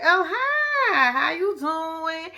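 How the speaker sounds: high sing-song imitation of a woman answering the phone; mock flirty woman. Woman answering Chance's call.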